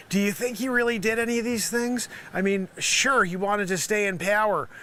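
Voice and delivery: usual speaking voice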